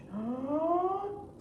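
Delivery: huge, high, drawn-in gasp; big inhale. Acted. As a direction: suck in air like a dragon. Dragon inhales deeply.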